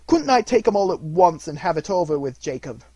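loud, high-pitched wheedling question; hopeful hinting. A narrator's reading. Scrooge angles to meet all spirits at once, hinting. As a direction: float it eagerly, dropping on the name. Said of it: "hinted Scrooge".

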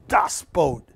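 lightly punched German accent on Das Boot; German pronunciation. Shown as a demonstration. Host naming the boat in German, light German accent. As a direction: say it with a touch of German. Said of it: "And in German".

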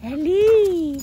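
loud ringing shout; real shout for the dog; calling out. Real, not acted. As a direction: call her across the yard.

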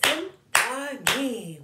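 loud, stretched chant of Symphony; clapped syllables. Shown as a demonstration. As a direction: beat out each syllable of Symphony. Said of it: "Clap it".

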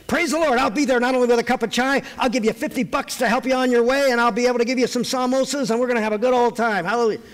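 high, loud eager enthusiasm; eager yes face. Pretend. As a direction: gush with willingness. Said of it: "if I have a yes face".

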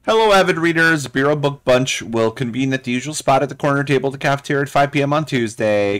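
a bubbly book club host reading her memo; high, loud, eager club organizer voice; perky Penny Bartwell. Acted. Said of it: "I don't know why I made her like that".

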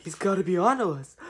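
ordinary talk, nothing marked

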